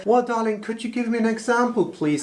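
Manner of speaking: put-on high, quick, overly polite British accent; a hammed-up British charm, darling and all; mock polite British accent. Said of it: "Why do you get a British accent when you're trying to be polite".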